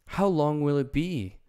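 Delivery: put-on a small girl asking, a little worried; Dorothy asking the Tin Woodman the way; Dorothy's voice.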